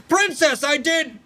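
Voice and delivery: very high, gasping call after a hard swim; breathless late arrival. Acted. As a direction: shout out, out of breath and too late. Drawn arrives too late.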